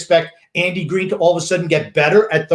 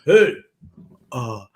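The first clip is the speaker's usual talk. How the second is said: lower, softer mock of a dull host; dull boring guy; 5 semitones lower, 4 dB quieter than usual. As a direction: drone it out like the dullest guy in the room. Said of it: "the dull, boring guy who sits there and just goes".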